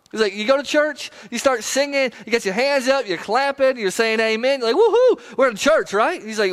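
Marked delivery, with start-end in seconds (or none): 4.8-5.9 high, whooping cheer; excited churchgoer. Acted. Churchgoer clapping and saying amen in worship, excited cheer.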